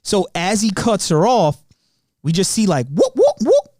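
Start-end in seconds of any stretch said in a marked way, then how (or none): 2.9-3.7 high, loud whoop-whoop like a police siren; siren imitation. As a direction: mimic the cop siren pulling you over.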